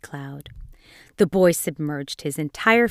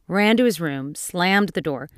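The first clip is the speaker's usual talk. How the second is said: ordinary delivery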